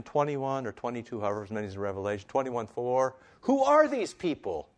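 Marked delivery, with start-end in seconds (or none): 3.4-4.7 high, loud quoted question; preacher's high-pitched dramatic asking; rhetorical wondering. Acted. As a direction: call out the question, pitched way up then falling. Acts out the question from Revelation.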